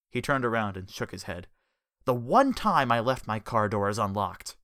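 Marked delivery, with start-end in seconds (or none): none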